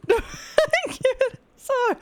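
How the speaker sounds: high, loud anime cry, breaking into laughs; laughing shout. Acted. Streamer shouting at Luffy in anime parody, laughing yell.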